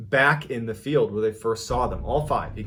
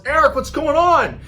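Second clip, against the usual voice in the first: high, very loud shout to Eric; panicked yell; 9 dB louder than usual. Acted. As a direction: yell it out from upstairs. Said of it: "she yells".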